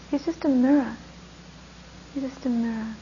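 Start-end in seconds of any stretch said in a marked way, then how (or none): 2.1-2.9 the line repeated much softer, hushed; a soft, sincere echo of the line. Shown as a demonstration. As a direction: let it fall away into quiet. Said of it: "repeated softer".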